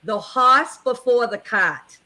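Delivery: New England accent, dropped r's. Shown as a demonstration. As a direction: horse as hoss, cart as caht, New England accent. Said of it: "in my New England accent".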